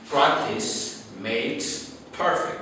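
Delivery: slow and soft, each word weighed; slow, deliberate saying. Shown as a demonstration. Teacher repeating a proverb slowly to students. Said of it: "Don't forget".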